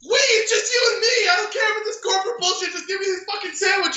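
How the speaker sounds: fast, high-pitched mock tirade; imitated furious rant. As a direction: rattle off a customer's exasperated blow-up. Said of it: "goes on this ridiculous rant".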